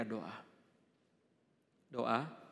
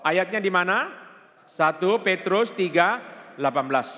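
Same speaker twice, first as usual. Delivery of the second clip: loud, raised preacher's voice; preaching at full force; 5 semitones higher, 13 dB louder than usual. Real, not acted. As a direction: drive it home loudly to the congregation. (khotbah berapi-api dengan suara lantang)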